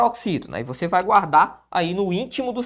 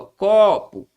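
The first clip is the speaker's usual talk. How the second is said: "Copo" with a long, strong first syllable; stress on CO; 4 dB louder than usual. Shown as a demonstration. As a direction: lean hard on the Co of "Copo". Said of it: "sílaba mais forte é essa daqui".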